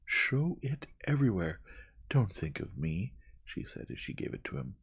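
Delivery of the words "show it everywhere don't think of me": high, loud opening command; feverish urgency. A narrator's reading. Young woman handing over signed declaration, feverish. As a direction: urge it fervently, then ease off. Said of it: "signed it in a feverish hurry".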